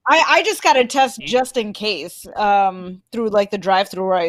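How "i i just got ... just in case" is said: ordinary talk, nothing marked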